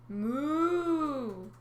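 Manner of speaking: high drawn-out moo; mooing sea cow. Acted. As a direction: moo like a sad calf. Cow serpent calls.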